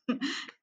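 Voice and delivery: quick, soft, high chuckle; small laugh. Real, not acted. Guest stopping after "basically access", small laugh. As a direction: break off with a little laugh. Laughs while losing the thread.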